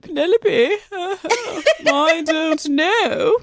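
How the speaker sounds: high, slow, laughing mock aristocrat voice; Dan Aykroyd impression. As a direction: ham up a posh old-money man, cracking up. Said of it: "of Dan Aykroyd".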